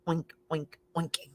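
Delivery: soft whispered oinking. Acted. Farmer Brown hushing the pigs' oinking, whispered. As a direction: whisper the oinks away.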